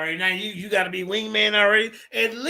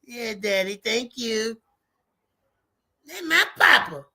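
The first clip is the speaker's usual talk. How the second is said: mock grateful son. Teasing the debater as the host's grateful boy, mock sweet. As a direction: play the beaming kid thanking daddy. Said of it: "look how Judah smile".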